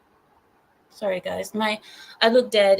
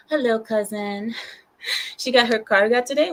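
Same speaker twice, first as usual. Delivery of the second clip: ordinary talk, nothing marked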